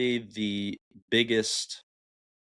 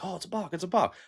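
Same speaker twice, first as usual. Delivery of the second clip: mock crowd outcry; 8 semitones higher, 3 dB quieter, faster than usual. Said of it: "The whole crowd all of a sudden is like".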